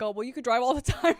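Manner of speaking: loud, high mock quote breaking into laughter; laughing imitation. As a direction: imitate the soulmate while cracking up. Imagined soulmate quote, dissolving in laughter.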